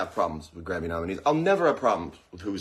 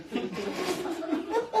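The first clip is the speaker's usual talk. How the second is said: high laugh; 6 dB quieter than usual. Real, not acted. Laugh after the joke.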